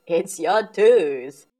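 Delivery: loud, slow, amused dad voice; booming fond dad, taking his time; Daddy Pig chuckling. A narrator's reading. Dad tells daughter it's her tooth, loud slow chuckle. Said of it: "Daddy Pig laughs".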